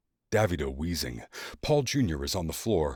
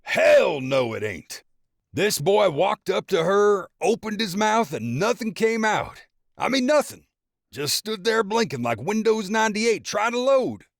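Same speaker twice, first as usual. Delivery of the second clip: mocking father; 13 semitones higher, 6 dB louder than usual. A narrator's reading. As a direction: point and roast the son. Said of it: "Paul pointing at Lucky".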